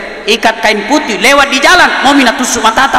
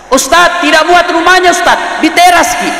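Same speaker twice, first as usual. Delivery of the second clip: high, pleading call to the preacher; a worried villager calls out to the ustadz; eager congregant asking; 8 semitones higher than usual. Retold or imitated. Jamaah bertanya ke ustadz. (jamaah memanggil ustadz dengan nada tinggi)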